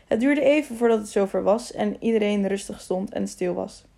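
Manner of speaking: ordinary delivery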